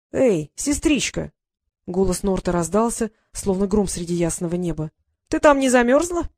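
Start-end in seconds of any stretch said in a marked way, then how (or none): 0.1-1.3 drawn-out mocking call through a door; teasing brother. Brother taunts sister through shed door, slow mocking call. 5.3-6.3 higher, louder taunting question; mocking. Brother mocks locked-in sister, higher louder taunt.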